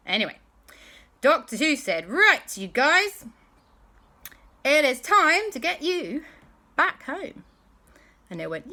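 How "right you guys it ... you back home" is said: higher, louder, slow commanding call; rallying call. Acted. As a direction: gather the group to head home. Doctor Who rallying everyone.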